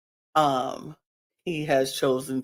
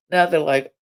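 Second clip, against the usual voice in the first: ordinary delivery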